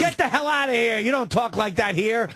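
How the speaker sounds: stern manager. Retold or imitated. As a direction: scold like a strict manager. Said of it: "And then Kenny goes".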